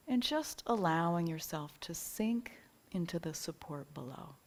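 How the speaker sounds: calm meditation guide, short phrases with long pauses; unhurried yoga nidra guidance, leaving space for each breath; guided relaxation. Real, not acted. Teacher easing listeners into yoga nidra rest, calm guided relaxation.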